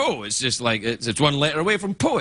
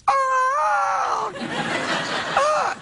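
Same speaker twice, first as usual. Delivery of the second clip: put-on drunken caw. Drunk Baudelaire squawking the Raven's call. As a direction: caw like a drunk poet slurring French. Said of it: "he just translated The Raven by going".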